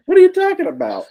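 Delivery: high, fast disbelief; incredulous. Real, not acted. Brother asking a favor, real incredulous outburst. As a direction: blurt it out in disbelief.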